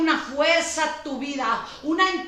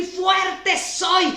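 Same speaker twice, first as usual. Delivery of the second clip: shouted declaration; 4 semitones higher than usual. Shown as a demonstration. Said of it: "Entonces di".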